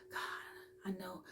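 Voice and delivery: voiceless whisper to God. Retold or imitated. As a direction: breathe it out alone in the bathroom. Alone in bathroom talking to God.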